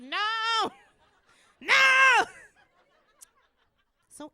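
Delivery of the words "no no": high, very loud yell; yelled refusal. Acted. Owner refusing the cat her babies, hammed-up shout. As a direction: bellow it, stretched and high.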